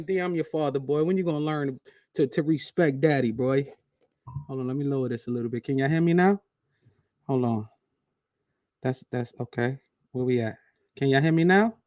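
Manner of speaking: ordinary talk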